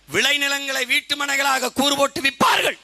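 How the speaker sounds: high, loud prophetic declaration; proclaiming shout. Retold or imitated. Speaker quoting an elder's prophecy to the crowd, booming proclamation. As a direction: thunder it out like a prophecy.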